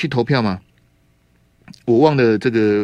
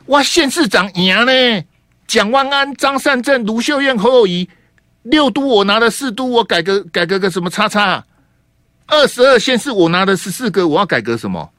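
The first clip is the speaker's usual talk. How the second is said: mocking party boss; 7 semitones higher than usual. Host voices the KMT's smug view.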